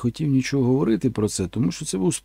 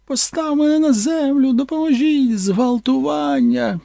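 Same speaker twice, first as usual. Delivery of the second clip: high shrill-free screaming cry for help, much higher and louder; mock screaming actress; 12 semitones higher, 4 dB louder, slower than usual. Actress screaming at the creature to put her down, high mock scream. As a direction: scream like a panicked starlet. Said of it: "і починає кричати".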